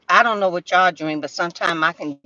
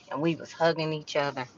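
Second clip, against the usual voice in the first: usual speaking voice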